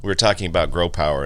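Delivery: ordinary talk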